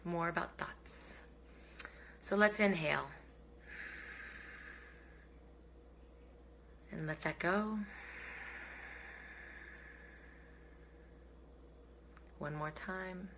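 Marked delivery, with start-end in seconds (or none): 2.2-13.3 soft, hushed meditation cue with long pauses; calm breathing guide, nearly whispering by the end; guided breathing. Real, not acted. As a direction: gently lead the breath, fading quieter. Leads slow breathing.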